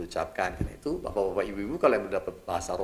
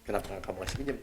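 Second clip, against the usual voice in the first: a softer, hushed voice of conscience; inner voice; 3 semitones lower, 8 dB quieter, faster than usual. Retold or imitated. Conscience prompting the preacher to lend money, quiet and hushed. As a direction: ask it gently like a thought in the heart. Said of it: "dalam hati saya muncul".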